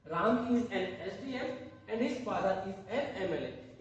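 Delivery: slow, word by word, with a laugh; teacher reads the finished answer to students, slowly; slow dictation. Shown as a demonstration. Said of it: "तो यहाँ पे क्या आ जाएगा?".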